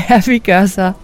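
reply through a chuckle; amused, laughing agreement. A narrator's reading. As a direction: say it while still laughing. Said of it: "Jeg ryster på hovedet og siger grinende".

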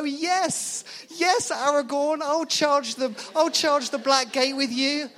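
high, loud, hammed-up battle enthusiasm; fired-up follower. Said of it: "we hear that and go".

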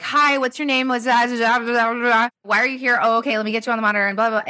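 high, hurried intake patter with no pauses; rushed robot train. Acted. Nurse hurrying a triage patient through intake on autopilot, rushed and high. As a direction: rattle through a mock-frantic patient intake. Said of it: "now you're on this robot train".

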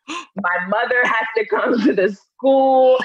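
hammed-up near-cry; mock wailing. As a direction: stretch each disaster out slowly. Dramatising her childhood humiliation.

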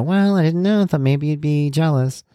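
high-pitched, oblivious mock remark; clueless. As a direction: imitate someone blithely unaware. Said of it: "in a clueless kind of a way".

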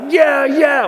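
high, loud yeah yeah; crowd cheering. Retold or imitated. Crowd roaring approval at the walker, high and loud. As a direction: roar it like a crowd.

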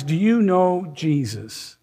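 ordinary talk, nothing marked

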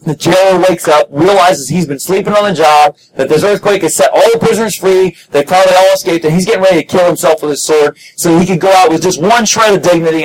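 ordinary delivery

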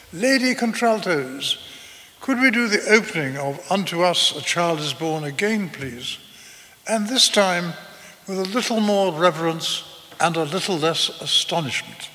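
a grand conductor gently mocking the altos; posh conductor voice. Sir Malcolm teasing the lady contraltos, clipped and posh. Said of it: "said in his chipped, posh voice".

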